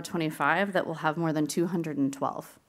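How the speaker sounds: normal talking voice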